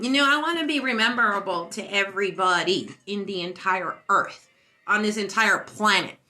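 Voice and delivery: ordinary talk, nothing marked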